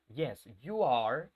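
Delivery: "Yes, you are." drawn out slowly; slow classroom model answer; slow drill model. Shown as a demonstration. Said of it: "respuestas cortas".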